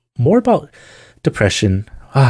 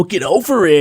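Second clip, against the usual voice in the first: higher, put-on voice of a careless fan; mock dismissive fan; 6 semitones higher than usual. Mocking a careless fan telling Kristen to get over it, in a high put-on voice. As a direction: brush off her sadness in a silly high voice.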